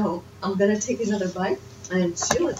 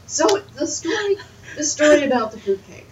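laughing; 7 semitones higher, 5 dB louder than usual. Real, not acted. Laughing start of story.

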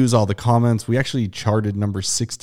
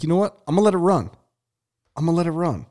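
higher, playful self-quote; retold inner voice; 8 semitones higher, faster than usual. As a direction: voice your own thought, higher. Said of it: "But I was like".

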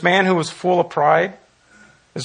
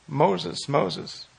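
soft double call; 7 dB quieter than usual. A narrator's reading. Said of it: "emphatic fashion".

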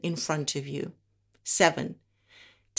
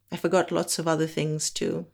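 normal talking voice

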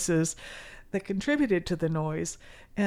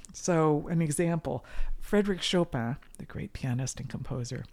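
ordinary talk, nothing marked

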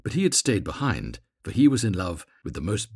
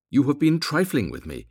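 raised, loud accusing voice; indignant cry; 6 semitones higher, 5 dB louder than usual. A narrator's reading. Swallow accusing the reed of trifling, indignant cry. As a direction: cry out as a wronged suitor. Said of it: "he cried".

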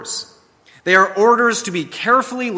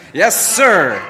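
louder, crisp yes sir; mock soldier reply; 2 semitones higher, 6 dB louder than usual. Boys karate chopping while singing, crisp mock salute. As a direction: snap it out like a salute. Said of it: "my sons karate chop each other".

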